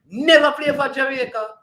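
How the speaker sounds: can't believe what he just read; incredulous repeat. Real, not acted.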